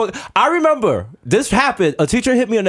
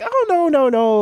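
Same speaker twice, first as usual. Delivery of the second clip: mock innocent teacher; 10 semitones higher, slower than usual. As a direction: play the teacher sweetly denying it all. Imitating teacher's denial.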